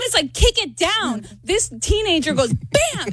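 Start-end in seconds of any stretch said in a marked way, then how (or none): 0.3-1.3 urging. Retold or imitated. Mom urging daughter, retold. 2.5-3.1 high, punchy impact word; explosive bang. Retold or imitated. Teenager kicks the stall door in, explosive. Imitates door being kicked in.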